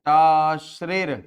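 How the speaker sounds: टाश्रेर धीरे, खींचकर, ज़ोर से; शब्द को धीरे-धीरे साफ़ बोलो; slow formula word. Shown as a demonstration. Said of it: "formula बनेगा". (कठिन सूत्र टाश्रेर को धीरे और ज़ोर से बोलना)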